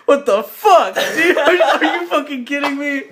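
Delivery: high, loud acted protest after a fright; scared outrage. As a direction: scold them as if badly startled. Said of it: "That scared the shit out of me".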